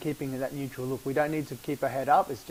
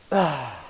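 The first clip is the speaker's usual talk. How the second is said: loud exhale sigh; 2 semitones lower, 4 dB louder than usual. Shown as a demonstration. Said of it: "And, let go".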